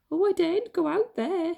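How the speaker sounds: high squeaky fearful voice; frightened squeak. Acted. Mother Mouse afraid of the cat, high frightened squeak. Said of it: "she squeaked".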